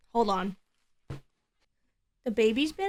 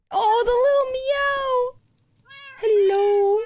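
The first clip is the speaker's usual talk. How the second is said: put-on baby talk; 12 semitones higher, slower than usual. Cooing at the kitten again.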